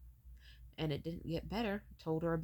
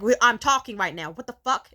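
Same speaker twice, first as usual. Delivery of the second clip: loud, raised, exasperated telling-off; angry mother; 5 semitones higher, 16 dB louder than usual. Retold or imitated. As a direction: imitate a mother snapping at an interrupting child. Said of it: "And then she got angry".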